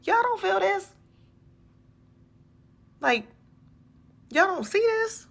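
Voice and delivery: high, loud disbelieving squeak; incredulous squeal. Retold or imitated. Talking to the congregation, a high squeaky disbelief. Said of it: "I just be like".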